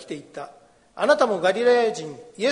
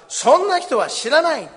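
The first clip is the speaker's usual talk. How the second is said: higher and louder, swearing he never knew him; vehement denial; 8 semitones higher, 7 dB louder than usual. A narrator's reading. Peter cursing and swearing to the bystanders, loud vehement denial. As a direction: throw the denial out like an oath.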